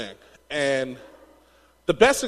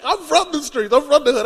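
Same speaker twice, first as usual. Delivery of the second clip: higher, hammed-up street-tough boast; mock tough street voice; 8 semitones higher, 4 dB louder than usual. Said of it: "I was, I was like".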